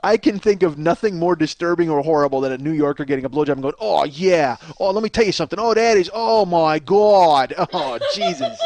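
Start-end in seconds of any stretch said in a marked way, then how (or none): 3.8-8.6 put-on Boston accent. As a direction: moan like a Boston guy, rising to a yelp. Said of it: "That might have been a Boston guy".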